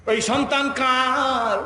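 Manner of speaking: high, loud, drawn-out question; calling out. Retold or imitated. Companion asking the woman about the child, loud slow shout. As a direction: shout the question slowly across the crowd.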